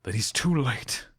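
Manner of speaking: soft, dying whisper. A narrator's reading. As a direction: breathe the words out faintly. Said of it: "Gavilar whispered".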